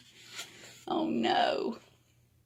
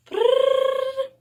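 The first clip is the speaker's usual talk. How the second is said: playful brrr; 4 dB louder than usual. Pretend. Playful noise at sign-off.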